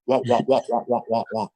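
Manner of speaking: siren wail done as spoken wahs; imitated police siren. Driver telling how the blue lights came on, mimicked siren.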